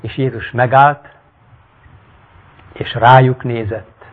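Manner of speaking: dramatic reading of a Bible-like tale; story narration. A narrator's reading. Reads the story aloud.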